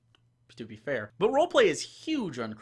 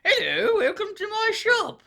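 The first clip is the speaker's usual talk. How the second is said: much higher and louder, a chirpy shopkeeper with a laugh in it; 10 dB louder than usual. Shown as a demonstration. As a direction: greet the party like an overeager merchant. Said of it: "never talk in the voice again".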